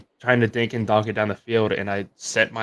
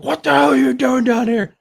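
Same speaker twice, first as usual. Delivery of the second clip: much higher, much louder mock dad yelling; angry dad; 14 semitones higher, 8 dB louder than usual. Dad coming down to scold his son, loud and angry. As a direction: bark it like a dad woken at ten thirty. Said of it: "and he was like".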